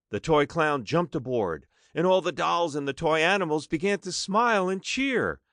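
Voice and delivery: usual speaking voice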